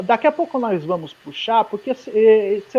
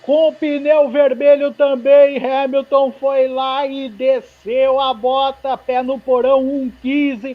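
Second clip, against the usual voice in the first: excited; 4 semitones higher, slower than usual. Real, not acted. Celebrating Hamilton's pole.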